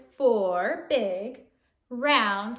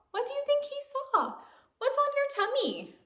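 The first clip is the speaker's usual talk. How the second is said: high quick teasing questions; playful questions; 11 semitones higher, 3 dB quieter, much faster than usual. Acted. Reader quizzing the children, high playful. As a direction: tease the kids with it.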